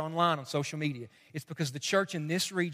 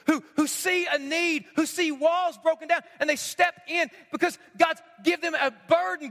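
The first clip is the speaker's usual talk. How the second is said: rousing sermon build; preaching; 9 semitones higher than usual. Acted. Pastor rallying the church to be wall builders, rousing. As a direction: lift the room toward the close.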